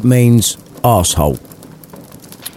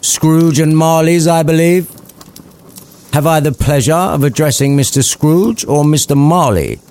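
posh polite gentleman; 7 semitones higher than usual. Acted.

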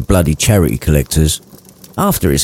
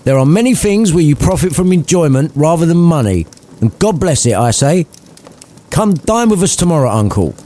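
cheerful nephew voice; 7 semitones higher than usual. Acted. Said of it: "nephew cheerfully pleads".

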